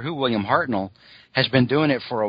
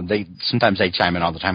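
ordinary talk, nothing marked

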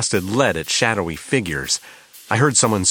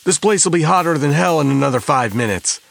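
shouting gangster; 8 semitones higher, 6 dB louder than usual. A narrator's reading. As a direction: bark at the gang to hurry. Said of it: "That was Tony's voice".

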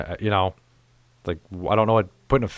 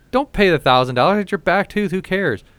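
excited advice; 10 semitones higher, 4 dB louder than usual. Retold or imitated. Advising his wife.

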